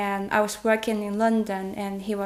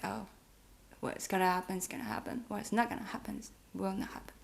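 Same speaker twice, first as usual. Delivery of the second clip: resigned inner voice; 10 dB quieter, faster than usual. Retold or imitated. Woman voices her partner's outlook to him, resigned. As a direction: murmur it like a shrug, imitating a thought.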